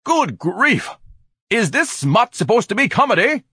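high, loud, scandalized outburst; indignant demand. A narrator's reading. Ignatius objects to smut in the dark theater, loudly indignant. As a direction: demand it as a pompous, appalled heckler. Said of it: "Ignatius demanded in the darkness".

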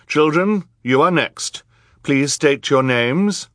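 high, loud, measured judge; judge, formal. A narrator's reading. Judge calling on the accused, formal. Voices the judge questioning the children.

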